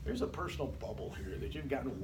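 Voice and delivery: a student whispering that the teacher is too close; whispered unease. Retold or imitated. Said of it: "sitting there going".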